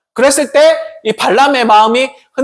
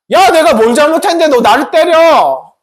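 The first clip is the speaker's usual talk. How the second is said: higher, louder indignant donkey; talking donkey; 7 semitones higher, 4 dB louder than usual. Acted. The donkey protesting to Balaam, high and loud. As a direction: protest being beaten unfairly. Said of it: "나귀가 말했어".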